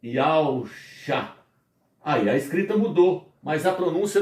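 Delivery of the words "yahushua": "Yahushua" slowly drawn out, syllable by syllable. Shown as a demonstration. Teacher showing the name as written in Portuguese, "Yahushua" slowly sounded out. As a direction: stretch "Yahushua" out very slowly as Yau-sha. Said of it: "Eu posso escrever no português".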